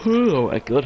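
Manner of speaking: talking through a yawn. Real, not acted. Tired commentator caught mid-yawn on air, yawning. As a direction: let a yawn stretch the words. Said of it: "I apologise for yawning so much".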